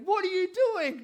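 high, loud playground shout; imitating son's shout. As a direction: bark it at a teammate like a kid copying dad. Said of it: "so shouting at him".

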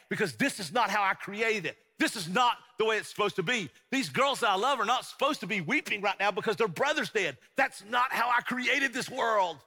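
high, forceful outrage at death; angry, indignant. Acted. Jesus protesting death as the sisters weep, angry indignation. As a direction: build to a loud, indignant protest. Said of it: "he's angry at death".

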